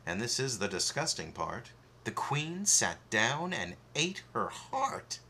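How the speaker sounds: usual speaking voice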